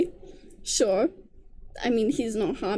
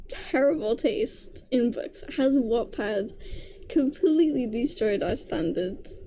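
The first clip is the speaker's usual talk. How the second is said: ordinary delivery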